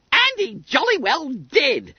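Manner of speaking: high, loud schoolboy crow; triumphant. A narrator's reading. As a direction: crow it in a squeaky voice. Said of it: "said Bunter triumphantly".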